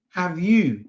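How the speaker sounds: ordinary talk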